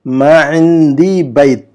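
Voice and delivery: slow, syllable by syllable Arabic; slow model reading. Shown as a demonstration. Teacher reads the Arabic example slowly for students. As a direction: read it out slowly for students to copy. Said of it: "number چھ کا جملہ پڑھیے".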